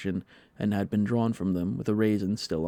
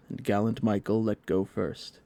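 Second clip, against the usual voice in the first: plain reading voice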